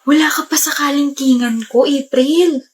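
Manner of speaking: whispered taunt in her ear. Acted. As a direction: hiss the taunt under your breath. Taunting rival over scores. (pabulong na pang-asar)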